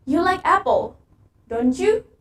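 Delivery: don't you rising at the end; asking for agreement, voice climbs on you; rising tag. Shown as a demonstration. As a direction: lift you up like a real question. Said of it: "tone of the question tag is rising".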